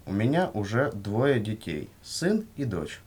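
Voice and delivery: usual speaking voice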